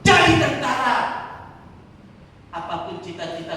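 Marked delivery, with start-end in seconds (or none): 0.0-1.0 raised, insistent preaching cadence; forceful preaching. Real, not acted. Preacher promising God's care to the congregation, rousing and forceful. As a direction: proclaim each promise, pushing the phrase ends.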